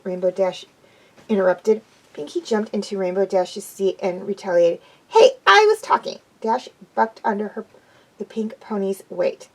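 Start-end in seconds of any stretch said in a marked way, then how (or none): 5.1-6.2 high, louder protest; Pinkie's voice. A narrator's reading. Pinkie protesting being cut off, high and loud. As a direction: whine it indignantly.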